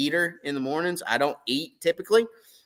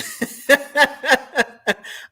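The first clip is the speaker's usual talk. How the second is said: high laugh; 10 semitones higher, 5 dB louder than usual. Real, not acted. Laughing before confession.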